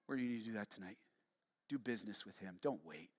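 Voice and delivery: low, soft pastoral urging; soft, low invitation to respond; hushed altar appeal. Real, not acted. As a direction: drop low and quiet to press the appeal.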